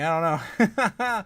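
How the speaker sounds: drawn-out laughing shrug. Real, not acted. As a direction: laugh it out, slow and loud. Laughing at chat joke.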